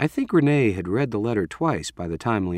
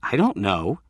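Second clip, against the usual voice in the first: usual speaking voice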